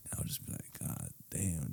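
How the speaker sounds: very soft, breathy, low murmur; imitating breathless amazement under the breath; hushed awe. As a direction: drop to almost a whisper, overwhelmed. Retold overwhelmed reaction to girl.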